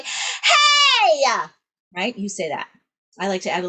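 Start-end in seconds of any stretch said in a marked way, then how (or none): 0.0-1.2 high, loud mock tantrum yell; scrunched toddler yell. Shown as a demonstration. Teacher plays toddler whose toy was taken, belted yell. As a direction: yell like a mad three-year-old. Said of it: "We're yelling at them".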